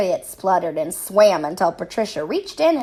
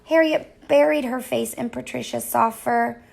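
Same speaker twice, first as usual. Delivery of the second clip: ordinary delivery, nothing marked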